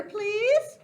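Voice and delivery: high, loud begging please; pleading please. Acted. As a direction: plead sweetly like a dad asking. Dad pleading for favor.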